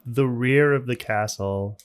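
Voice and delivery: ordinary delivery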